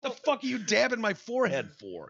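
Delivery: high, fast indignant mock rant; mock outrage. Host imagining snapping at the makeup artist, mock outrage. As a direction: snap at the man dabbing you.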